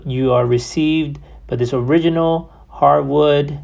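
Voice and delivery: ordinary talk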